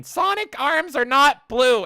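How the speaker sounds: high, drawn-out loud outburst; Chris Chan. Acted. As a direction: yell it slowly, way up in pitch. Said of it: "You're literally becoming Chris Chan".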